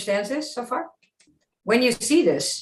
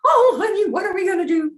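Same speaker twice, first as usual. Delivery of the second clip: high, pleading little voice; Minnie's line; 11 semitones higher, 4 dB louder, faster than usual. Shown as a demonstration. Coach shows Minnie's line, high and helpless. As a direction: play it helpless and worried. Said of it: "And Minnie says".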